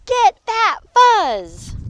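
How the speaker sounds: high, loud, drawn-out order; slow, heated battle cry; escalating command. Acted. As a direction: stretch each word into a high yell. Fight escalates.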